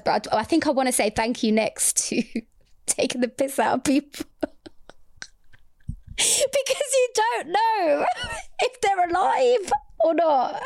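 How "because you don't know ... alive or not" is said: squeaky high, slow words through real laughing; helpless laughter. Real, not acted. Podcaster explaining her regret to co-host, helplessly laughing. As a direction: barely get it out between laughs. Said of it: "it makes me laugh".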